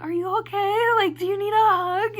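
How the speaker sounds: high-pitched voice of worried fans; concerned girls. Retold or imitated. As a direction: ask in a high, solicitous voice. Girls consoling her as she bawls.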